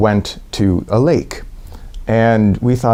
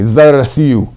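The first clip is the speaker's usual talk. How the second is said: raised toast voice, a little louder; 4 semitones higher, 5 dB louder, slower than usual. Real, not acted. Toasting the tent in Russian, proud raised voice. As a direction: lift the glass and declare it. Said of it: "I lift my glass and I just say".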